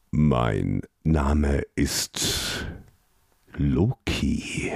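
very slow, low, drawn-out creature voice; slow reply. Acted. Creature slowly naming itself to the prince, slow low voice. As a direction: let each word hang, slow and low. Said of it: "antwortete das Wesen langsam".

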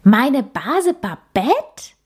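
slow, drawn-out astonished question. A narrator's reading. Käthe hearing her cousin's name, astonished rising. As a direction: stretch it out in disbelief. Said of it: "rief Käthe erstaunt".

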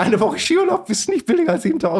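high-pitched, disbelieving retelling; incredulous inner thought. Retold or imitated. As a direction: repeat the absurd argument with pitch way up. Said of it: "Und dann denke ich".

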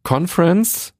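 conference englisch ausgesprochen, langsam und deutlich; English pronunciation. Shown as a demonstration. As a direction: das Wort conference auf Englisch, nicht Konferenz. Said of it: "Conference habe ich extra englisch ausgesprochen". (englische Aussprache von conference)

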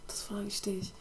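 hushed whisper into the mic. Real, not acted. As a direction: whisper it softly. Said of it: "Du hast geflüstert".

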